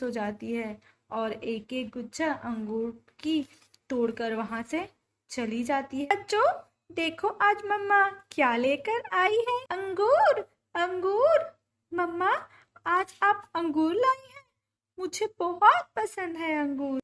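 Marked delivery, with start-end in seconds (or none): 6.1-17.0 very high, delighted bird voices; excited bird family. A narrator's reading. Mother bird showing children the grapes, excited. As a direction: share a treat in a high, thrilled voice.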